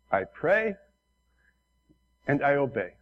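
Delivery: put-on light Korean-accented English, smiling; imitating the pastor's broken Korean accent. Retelling the Korean pastor's humble answer in Korean accent. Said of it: "in his broken Korean accent".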